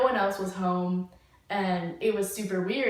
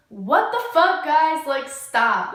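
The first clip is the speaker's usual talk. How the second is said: loud, much higher angry yell at the others; retold yell; 6 semitones higher, 9 dB louder, slower than usual. Annoyed guy yelling at the girls to stop, loud retold yell. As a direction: shout it like you think your friends are pranking you. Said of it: "he yelled that".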